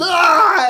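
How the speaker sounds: high mock scream; yelled Ah. Dad and son yelling at each other at the game, a mock scream. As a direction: let out a raw hammed-up yell. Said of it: "he was".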